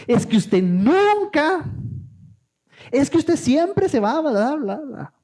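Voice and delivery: high, slow, drawn-out accusing whine; angry exaggeration. Arguing partner exaggerating in a fight, high and slow. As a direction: stretch out nunca like a bitter complaint. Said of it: "más exageraciones".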